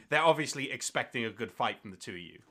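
normal talking voice

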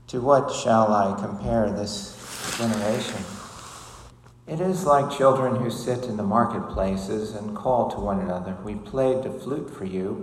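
loud, solemn gospel reading; gospel proclamation. Acted. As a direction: proclaim it to the whole church.

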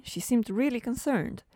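normal talking voice

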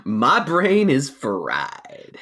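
very slow, stretched words; drawn-out. Pretend. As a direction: drag out every word. Repeating catchphrase, drawn out.

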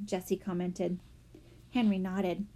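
usual speaking voice

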